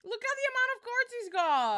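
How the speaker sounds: incredulous. Real, not acted. Shocked at another player's cards.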